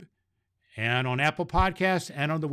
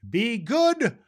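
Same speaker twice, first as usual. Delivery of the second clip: higher, louder, slow on goodness; jolly sign-off; 6 semitones higher, 4 dB louder, much slower than usual. Acted. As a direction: sing out the catchphrase to the children. Santa's sign-off catchphrase.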